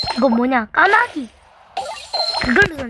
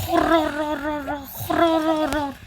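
slow rolled r trill; drawn-out playful trill noise; slow rolling trill; slower than usual. Pretend. Imitating water attack sound.

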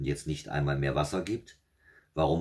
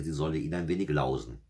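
plain reading voice

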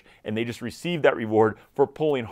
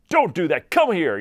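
higher, louder exasperated command; raised-voice scolding; 5 semitones higher, 5 dB louder than usual. Acted. Frustrated owner to a dog ignoring commands, raised voice. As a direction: scold the dog, voice climbing toward a yell. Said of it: "you start yelling louder".

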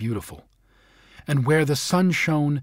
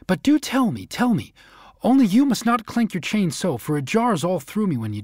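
higher eager pleading snowman; 6 semitones higher than usual. A narrator's reading. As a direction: beg him to tell, raised and eager.

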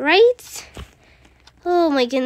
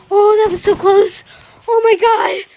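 relieved outburst; 3 semitones higher, 6 dB louder than usual. Real, not acted. Narrow escape in game.

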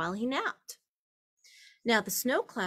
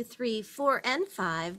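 ordinary delivery, nothing marked

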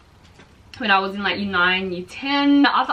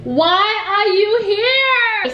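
surprised; 10 semitones higher, 4 dB louder, much slower than usual. Real, not acted. Friend arrives unexpectedly.

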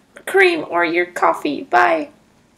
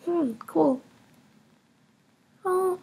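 ordinary talk, nothing marked

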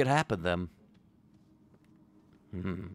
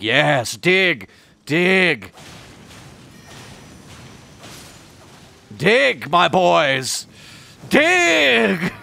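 loud, high, drawn-out commands; mock-gleeful bellow, rising to a high final cry; hammed-up urging shout; 8 semitones higher, 8 dB louder, much slower than usual. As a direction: egg them on at full voice. Acted quote urging on, shouted.

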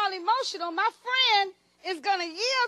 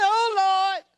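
1 mocking echo; 4 dB louder than usual. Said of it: "I look at you and say".

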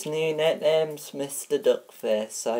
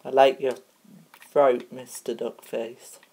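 normal talking voice

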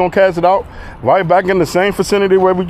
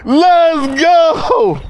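high, drawn-out victory yell; triumphant cheer; 3 semitones higher, much slower than usual. Real, not acted. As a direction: cheer yourself on after a big win. Celebrates the catch.

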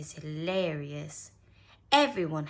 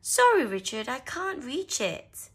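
Mummy Rabbit apologising; 9 semitones higher, 8 dB louder than usual. A narrator's reading. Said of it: "said Mummy Rabbit".

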